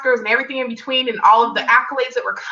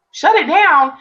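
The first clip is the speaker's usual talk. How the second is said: a higher, louder, disbelieving Shut it down?; incredulous question; 6 semitones higher, 4 dB louder, slower than usual. Retold or imitated. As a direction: echo the order in shock. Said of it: "And I'm like".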